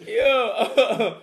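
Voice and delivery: ordinary talk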